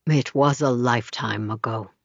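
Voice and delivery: low, sad and resigned; a man's quiet sorrow. A narrator's reading. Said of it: "says Charles sadly".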